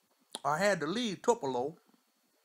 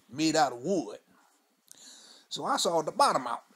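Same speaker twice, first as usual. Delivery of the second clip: put-on old Mudbone; 3 semitones higher, 4 dB louder than usual. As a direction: spin the yarn like a weathered old man on the porch. Old storyteller character throughout.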